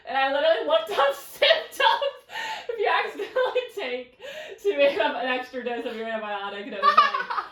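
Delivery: giggling through the words; high, loud, breaking into laughter; laughing while talking. Real, not acted. Retelling a silly panic, laughing.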